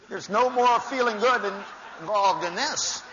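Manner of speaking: high, loud mock indignation; squealing mock protest with a laugh; mock outrage. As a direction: ham up the wronged spouse. Mocking the disappointed spouse.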